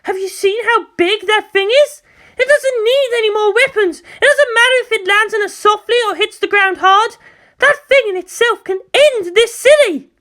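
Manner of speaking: outraged commander. Acted. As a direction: plead in fury. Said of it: "yelled out".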